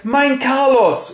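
high, slow, drawn-out call of his name; gushing greeting. Retold or imitated. Friend greets the sulking prince, high and drawn out. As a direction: sing out the name like an overjoyed old friend.

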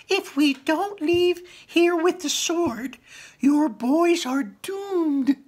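very high, slow creature voice; manticore. Acted. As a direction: speak slowly and high as the manticore. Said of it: "said the Manticore".

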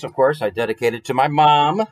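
plain reading voice

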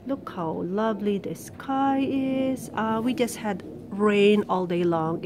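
normal talking voice

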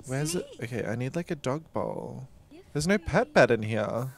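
normal talking voice

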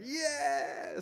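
high, drawn-out mock cheer; imitated crowd yell. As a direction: yell back like a far-off audience, long and high. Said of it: "they would yell back".

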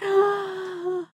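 high, soft squeaky gasp; thin high squeal on a gasp; overcome, tearful-sounding high gasp; strange excited noise. Real, not acted. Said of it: "I've never heard you make that noise".

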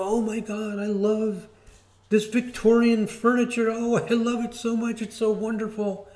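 high-pitched gushing enthusiast; all excited. Acted. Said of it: "People who get all excited".

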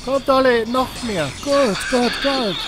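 high gleeful taunt with a laugh. Real, not acted. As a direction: relish the fight. Eager taunting, laugh heard.

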